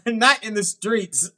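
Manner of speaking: ordinary talk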